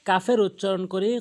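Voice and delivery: ordinary delivery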